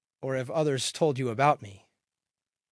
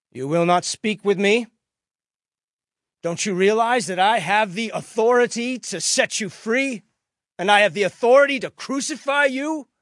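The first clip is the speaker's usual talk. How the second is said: raised, louder, rising in pitch as it goes; indignant questioning; 8 semitones higher, 9 dB louder than usual. A narrator's reading. Pilate pressing silent Jesus, loud indignant questions. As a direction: pull rank on a silent prisoner.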